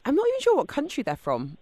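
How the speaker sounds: ordinary talk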